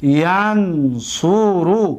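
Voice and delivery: drawn out very slowly for students; slow demo. Shown as a demonstration. Teacher spelling out ینصر for students, slowly. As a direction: stretch each sound of ینصر slowly. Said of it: "دقت دادید؟".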